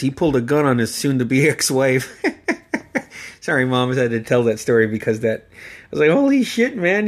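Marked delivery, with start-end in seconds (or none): 6.1-7.1 high, stunned exclamation; shocked holy shit. Retold or imitated. Nephew hearing about the gun, high and stunned. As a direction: blurt it out in disbelief.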